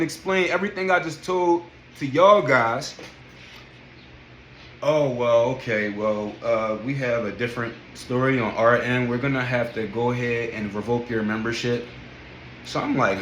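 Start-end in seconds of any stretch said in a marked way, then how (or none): 4.8-11.9 lightly voiced gym staffer, low and measured; low, restrained official delivering bad news. Gym staffer revoking a membership by phone, lightly imitated.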